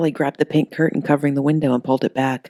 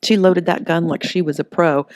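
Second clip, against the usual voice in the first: ordinary delivery, nothing marked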